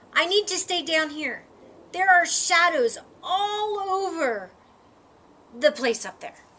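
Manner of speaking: plain reading voice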